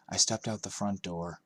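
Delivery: ordinary delivery, nothing marked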